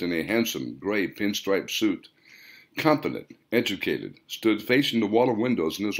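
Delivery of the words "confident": usual speaking voice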